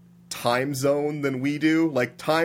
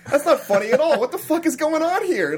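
higher and louder, "not" pushed up hard; exasperated outburst; 13 semitones higher, 3 dB louder than usual. Real, not acted. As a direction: let the disbelief burst out on "not funny at all". Reacting to the film scene.

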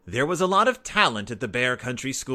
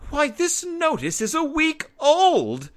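much higher, slower exclamation of dismay; surprised mother; 5 dB louder than usual. A narrator's reading. Mama finding the week-old notice, high indignant surprise. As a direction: act as if the news is shocking.

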